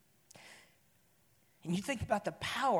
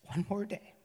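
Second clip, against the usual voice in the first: "One more day" said again, softer and hushed; softer repeat; 6 dB quieter than usual. Retold or imitated. The father repeating his promise to his son, softer. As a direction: let the repeat fall away quietly.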